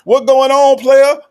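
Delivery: high, loud call-out; hyped-up welcome to the phone caller; loud hype greeting. Pretend. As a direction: shout the greeting across the line.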